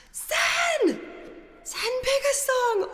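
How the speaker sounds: shouting thanks at a donor; excited shout. Real, not acted. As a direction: yell the donor's name in delight.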